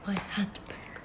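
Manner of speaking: soft whispered line. Real, not acted. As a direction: breathe it out quietly. Whispering the title again.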